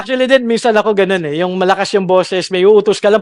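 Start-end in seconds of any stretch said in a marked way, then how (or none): none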